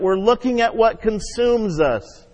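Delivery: usual speaking voice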